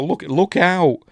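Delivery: high, loud look-out call; mock warning shout. Acts out a warning to the victim.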